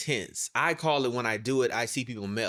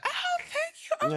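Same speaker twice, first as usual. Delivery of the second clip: very high, slow flattered thank you; mock flattered; 4 dB quieter, slower than usual. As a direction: gush a coy thank you. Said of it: "people take and be like".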